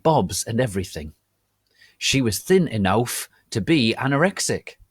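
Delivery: loud, over-the-top dramatic reading. Acted. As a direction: read the fan fiction like grand theatre. Dramatic reading of fan fiction.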